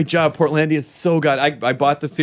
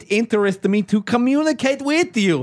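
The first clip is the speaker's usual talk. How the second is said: lightly put-on Eastern European accent, higher; mock foreign accent; 5 semitones higher than usual. Podcast host reads a spam love letter in mock Russian accent. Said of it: "I'll read it in the accent I assume it was written in".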